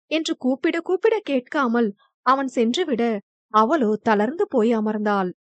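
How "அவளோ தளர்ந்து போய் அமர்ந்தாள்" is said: ordinary delivery, nothing marked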